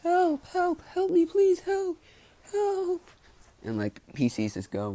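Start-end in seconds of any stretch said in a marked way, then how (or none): 0.0-3.0 very high, strained falsetto pleading; a tiny squeaky voice calling help; high-pitched cry for help. Retold or imitated. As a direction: squeak out a desperate plea far above your own voice. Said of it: "and he just says".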